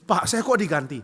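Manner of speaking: acted hurt appeal; aggrieved complaint. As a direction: complain to the pastor. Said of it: "dia bisa curhat ke saya".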